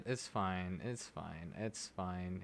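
low, very soft, tight repetition; muted self-reassurance. Real, not acted. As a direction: talk yourself down under your breath. Talking self down after bad pull.

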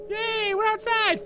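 put-on high, drawn-out little-kid cheer; gleeful child. Fluffy finally outside, gleeful child cheer. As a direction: cheer slowly in a squeaky kid voice.

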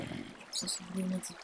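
sorry breathed out in a whisper, barely voiced. Real, not acted. Apologizing to the mob he killed, in a whisper. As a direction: trail off into a guilty whisper.

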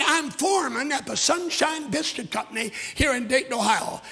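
proud, loud boasting; a cousin bragging to the preacher at full volume; boastful cousin. Retold or imitated. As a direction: brag it out big and loud. Boastful cousin quoted in sermon.

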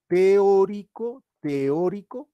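"Teórico" stretched out very slowly, rising at the end; stressing teórico. Shown as a demonstration. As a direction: drag out "Teórico" to drive the word home. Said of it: "sino es teórico práctico". ("Teórico" alargado y lento, subiendo al final)

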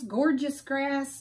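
ordinary delivery

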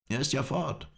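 high-pitched lament; high, distressed character voice; dismayed ally. A narrator's reading. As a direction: wail it as the robbed owner. Mohammed Emin laments stolen horse.

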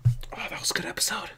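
softly whispered, no voice, moved; awed whisper. Real, not acted. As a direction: let it slip out as a hushed whisper. Whispered awe again.

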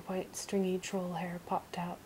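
plain reading voice

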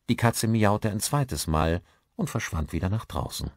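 ordinary delivery

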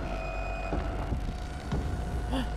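long voiceless gasp; drawn-out scared intake of breath; frightened gasp. Real, not acted. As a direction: suck in breath in fear. Fleeing a terrifying figure.

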